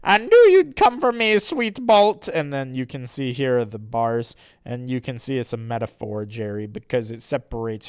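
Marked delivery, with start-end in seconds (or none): 0.0-2.3 high, loud damsel greeting her hero; hammed-up high-pitched heroine; Rose Chew. Rose Chew greets her rescuer, high and theatrical. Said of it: "Rose Chew says".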